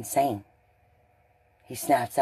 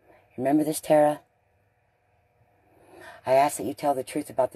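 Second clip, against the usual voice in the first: raised, pointed call to Tara; 3 semitones higher, 5 dB louder than usual. Real, not acted. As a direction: call her out by name, louder and higher. Calling out to Tara directly.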